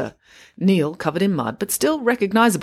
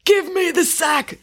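high, drawn-out robber's demand; 13 semitones higher, slower than usual. A narrator's reading. Robber to reluctant shopkeeper, demanding. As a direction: stretch out the command forcefully. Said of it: "demanded the robber".

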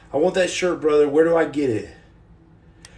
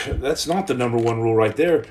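ordinary talk, nothing marked

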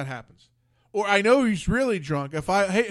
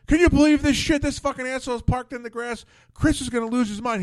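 mock outraged rant, higher and louder at first; imitating angry rant; 6 semitones higher, 4 dB louder than usual. Pizza shop owner ranting to neighbor about car on the grass, mock outraged. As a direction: play the neighbor flipping out over a parked car. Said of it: "He's flipping out".

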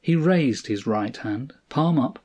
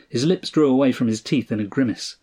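plain reading voice

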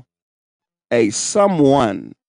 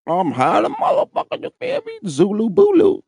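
mock high; 10 semitones higher than usual. Acting out being high on narcotics.

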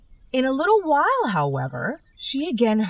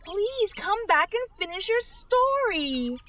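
calling after; 12 semitones higher than usual. A narrator's reading. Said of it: "Alice called after it".

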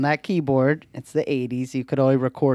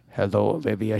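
spooky phone voice; 5 semitones lower than usual. Pretend. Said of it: "so you could be like".

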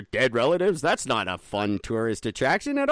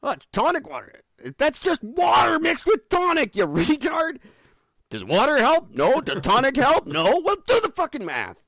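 angry rant; 11 semitones higher, 4 dB louder than usual. Real, not acted. Ranting about tonic water claims.

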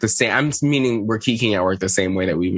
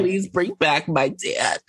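mock tearful plea; 7 semitones higher, slower than usual. Imitating Gia crying in bed.

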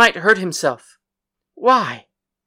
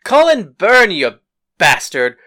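loud, angry shout; furious yell; 2 semitones lower, 8 dB louder than usual. A narrator's reading. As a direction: bellow him awake. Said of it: "and then yelled".

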